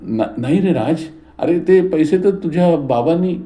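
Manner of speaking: mother pleading with son, voice trembling. A narrator's reading. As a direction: falter while pleading innocence. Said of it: "सावित्रीबाईंचा आवाज थरथरला".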